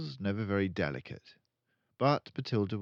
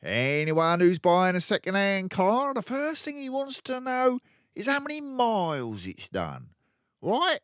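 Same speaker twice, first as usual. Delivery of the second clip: loud, high, gloating crook; boastful dealer; 8 semitones higher, 6 dB louder than usual. Acted. Father bragging to his son Michael, loud swagger. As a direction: lecture your son with swagger. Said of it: "he went on".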